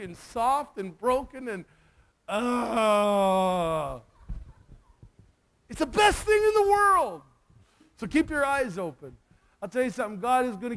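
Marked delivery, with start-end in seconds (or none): 2.3-7.2 tearful joy. Real, not acted. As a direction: let it burst out through tears. Overcome recalling the conversion.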